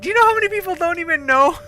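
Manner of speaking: very high, loud, fast rush; mock incredulous outburst. Teller challenging her friend about Azerbaijan, high incredulous outburst. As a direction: squeal it out in comic disbelief. Retold argument reaching its peak.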